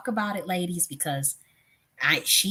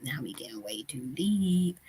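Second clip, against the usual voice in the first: playful whisper; 2 semitones lower, 6 dB quieter than usual. Pretend. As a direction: drop to a secret whisper. Joking that topic is too deep.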